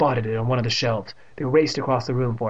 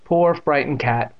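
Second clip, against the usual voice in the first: ordinary delivery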